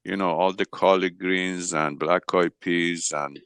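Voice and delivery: normal talking voice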